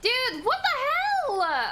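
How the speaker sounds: stunned disbelief. Real, not acted. Ambushed by enemy player.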